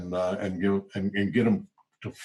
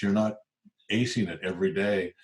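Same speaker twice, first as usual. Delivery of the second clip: usual speaking voice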